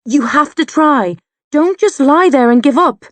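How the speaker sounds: high, loud pleading shout. A narrator's reading. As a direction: keep yelling at him not to quit. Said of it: "I shout again".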